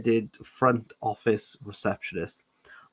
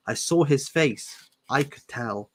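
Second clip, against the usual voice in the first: ordinary talk, nothing marked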